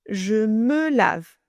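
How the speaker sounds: "Je me lave" spoken slowly, each word set apart; slow model phrase. Shown as a demonstration. Teacher models "Je me lave" slowly for learners. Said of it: "Chunk them with me, slowly".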